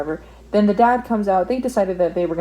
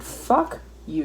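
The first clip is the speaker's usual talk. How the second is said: ordinary talk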